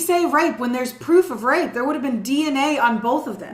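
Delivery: loud, high-pitched exasperated outburst; indignant disbelief. Real, not acted. Host picking apart a suspect's story to co-host, loudly indignant. Outraged at an implausible claim in case.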